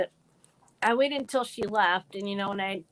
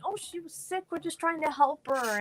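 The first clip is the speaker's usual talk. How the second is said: put-on higher, falsely sweet mean-girl voice; fake innocence; 7 semitones higher than usual. Bullies lying to the Spanish teacher, high fake innocence. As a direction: play innocent for the teacher, pitched up. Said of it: "they're acting like".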